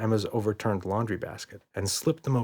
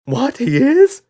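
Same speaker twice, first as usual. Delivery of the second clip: much higher, loud panicked yelp; 11 dB louder, slower than usual. A narrator's reading. Red hears the dad is right there, yelping in panic. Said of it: "yelped Red".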